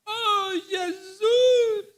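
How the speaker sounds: high, loud wailing shout; soul cry. Retold or imitated. Preacher imitating Brother Viana's loud, wailing soul cry. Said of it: "aquele ecozinho assim".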